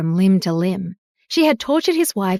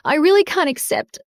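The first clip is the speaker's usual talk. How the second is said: on the edge of tears; 7 semitones higher than usual. A narrator's reading. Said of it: "burst into tears".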